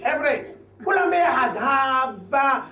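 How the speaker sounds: put-on high, drunken rallying call to the crowd; drunk party cheer. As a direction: whoop it up like a tipsy host. Drunk man rallying everyone.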